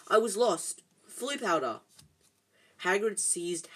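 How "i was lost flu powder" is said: louder, relieved outburst; mildly croaked, blurted explanation. A narrator's reading. Harry explains himself to Hagrid, relieved croak. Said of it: "Harry croaked in relief".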